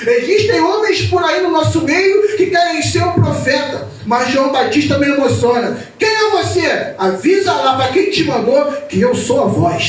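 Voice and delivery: loud, high, impassioned sermon delivery; fiery preaching. Acted. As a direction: preach it out to the congregation. Preaching at full force.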